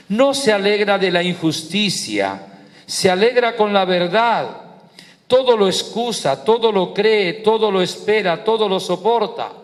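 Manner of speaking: high, rhythmic declaimed litany; scripture recitation. Acted. Preacher proclaiming what love endures to the congregation, high rhythmic declamation.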